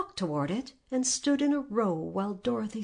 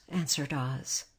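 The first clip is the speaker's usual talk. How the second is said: ordinary delivery, nothing marked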